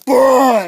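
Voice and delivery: very loud explosive roar; imitated fire blast. Godzilla breathing fire, imitated explosive roar. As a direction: blast it out suddenly, high and loud.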